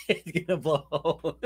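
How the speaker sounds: words breaking into laughter; laughing while talking. Real, not acted. Watching host light up, talking through real laughter. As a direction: crack up through the line.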